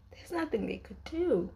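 sighing, high, helpless lament; sigh, then lift the voice in helpless dismay; pained lament. Real, not acted. Fan lamenting a couple's doomed love, pained and high. Despairing repeat of painful realisation.

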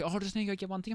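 high-pitched voicing of old people. Old customers pleading with door staff, high mock voices. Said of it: "there was old people like".

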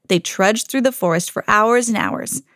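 usual speaking voice

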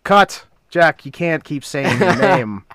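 loud, high director's reprimand; exasperated film director. Pretend. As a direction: call cut and scold the actor, mock-exasperated. Mimes director scolding the actor.

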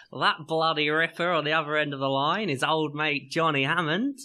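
hammed-up Aussie accent; mock Australian patter; put-on Australian accent. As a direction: introduce a co-host in broad Australian. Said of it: "I'm changing accent for dramatic effect".

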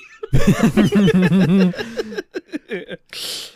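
hearty laughter after punchline; loud laugh. Real, not acted. Laughing at own story.

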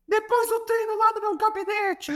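high, stern mock coach with a Portuguese accent; Jorge Jesus impression. Coach ordering his player to his office, high mock Portuguese voice. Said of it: "ele grita assim".

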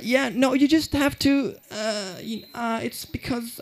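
high, fading clerk stammer; flustered clerk voice. Retold or imitated.

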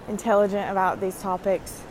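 ordinary talk

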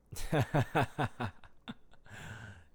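bright burst of giggles. Real, not acted. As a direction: giggle to yourself. Giggling at thought.

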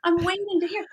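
high-pitched imitation of people stalling; hesitant believer. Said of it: "some people are like".

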